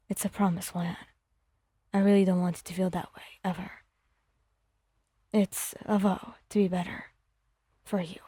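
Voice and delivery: a hushed promise, pressed close; whispered vow. Acted. Bakugo vowing to Wyon, low and hushed.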